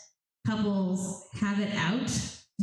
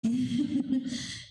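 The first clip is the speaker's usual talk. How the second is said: a short real laugh at the story; breathy chuckle, pitched up; light amused laugh; 5 semitones higher than usual. Real, not acted.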